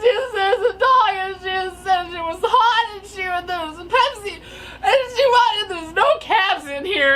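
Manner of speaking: hammed-up high, loud blubbering; mock crying, words tumbling into whimpers; verge of tears. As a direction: wail incoherently to mom through mock sobs. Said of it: "on the verge of tears".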